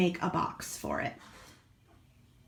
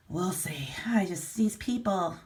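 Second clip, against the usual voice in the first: whispered under the breath, slow; a muttered whisper of annoyance; exasperated whisper; 2 semitones lower than usual. Real, not acted. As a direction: hush it, fed up with these buyers. Exasperated aside to viewers.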